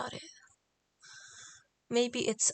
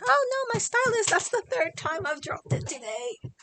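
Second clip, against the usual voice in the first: high squeaky cry of dismay; dismayed squeal; 10 semitones higher, 5 dB louder than usual. Real, not acted. Streamer drops her stylus for the third time, high squeal. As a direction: squeal it as the pen falls.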